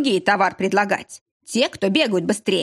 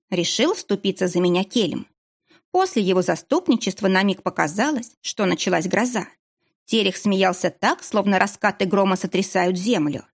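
ordinary delivery

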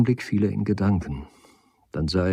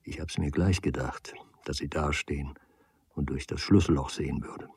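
leise geflüstert, fast tonlos; verschwörerisch flüstern; 6 semitones lower, 10 dB quieter than usual. A narrator's reading. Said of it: "flüsterte". (er flüstert Kammer leise zu)

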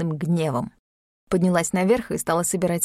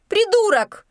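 пронзительный визгливый вскрик, очень высоко; взвизгни от пинка; визг; 13 semitones higher, 4 dB louder, slower than usual. Acted. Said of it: "взвизгнула Юлька". (визгливо, намного выше и громче)

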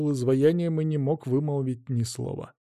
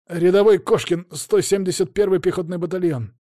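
soldier's report; 6 semitones higher, 4 dB louder than usual. A narrator's reading.

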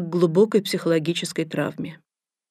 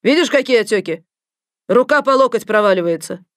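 scolding; 3 semitones higher, 5 dB louder than usual. Acted. Doctor scolding the patient.

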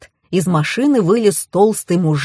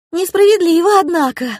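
put-on high, louder girlish protest; pitched-up reproach; reproachful; 10 semitones higher, 4 dB louder than usual. A narrator's reading. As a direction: scold in a high, indignant girl's voice. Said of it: "укорила меня создательница".

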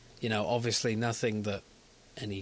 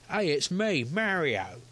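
higher, deliberately un-Italian accent; Mario without Italian accent; 5 semitones higher, slower than usual. Shown as a demonstration. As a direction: pitch it up and drop any Italian accent. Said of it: "sounds nothing like an Italian accent".

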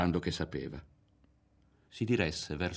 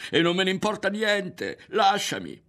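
high, loud, straining outburst; near shout; 13 semitones higher, 7 dB louder than usual. A narrator's reading. Ario telling Berto to let him go, almost shouting. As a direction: burst out as if about to scream. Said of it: "fu per urlare Ario".

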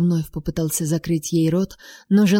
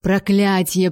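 usual speaking voice

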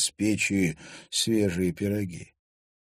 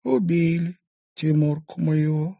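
sighing grief; 9 semitones higher than usual. A narrator's reading. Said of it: "вздохнула Устинья".

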